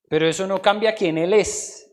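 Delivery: ordinary talk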